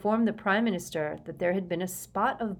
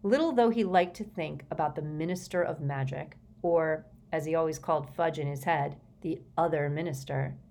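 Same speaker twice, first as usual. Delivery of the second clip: plain reading voice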